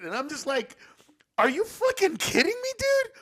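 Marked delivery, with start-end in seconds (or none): 1.4-3.1 much higher, loud incredulous shout; exasperated yell. Retold or imitated. Son shouting in disbelief at his proud father. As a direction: blurt it out in disbelief.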